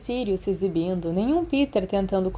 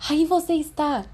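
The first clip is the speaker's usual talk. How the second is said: anxious gasp, higher; gasped relief; 8 semitones higher than usual. Acted. Peter finds Remus at last, anxious gasp. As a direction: blurt it out, relieved and breathless. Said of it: "ele engasgou".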